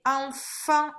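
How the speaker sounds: "enfant" slowly as en-fant; teacher's drawn-out syllable split of "enfant". Shown as a demonstration. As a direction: sound out "enfant" in two separate syllables. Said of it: "feu sur la deuxième syllabe".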